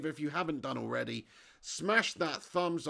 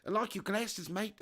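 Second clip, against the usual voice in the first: attempted South African accent, higher pitch; 5 semitones higher than usual. Streamer obliging a viewer's request, South African accent. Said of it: "in a South African accent".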